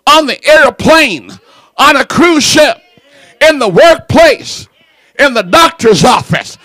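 loud, shouted, slow sermon cadence; hollering preacher at full force; full-force preaching. Acted. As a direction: thunder it from the pulpit.

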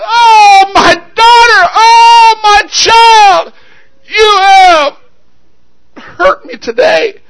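high, loud, drawn-out wail; anguished screaming. Acted. Preacher as father on his knees before his daughter, screaming in anguish. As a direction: cry out in full-voiced grief. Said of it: "started screaming".

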